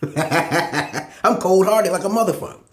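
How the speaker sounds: slow, laughing through the words; laughing boast. Real, not acted. Bragging to the crowd about being cold-hearted, laughing. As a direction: chuckle it out slowly, pleased with himself.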